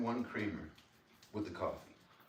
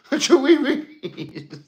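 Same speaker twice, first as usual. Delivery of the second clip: very high, slow, loud squeal through laughter; giggly falsetto catchphrase; laughing squeal; 13 semitones higher, 11 dB louder, much slower than usual. Pretend. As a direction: cackle out the nonsense call.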